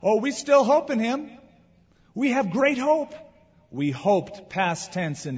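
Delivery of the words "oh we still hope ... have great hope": higher and louder, mock cheerful hope; mock upbeat. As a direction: play the disciples' false optimism, hammed-up.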